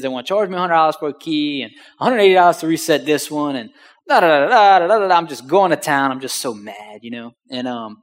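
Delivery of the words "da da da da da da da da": loud, higher mock griping on nonsense syllables. Griping to dad on the phone, loud mock rant. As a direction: rattle off a complaint in loud da-da-das. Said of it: "I'm griping and complaining".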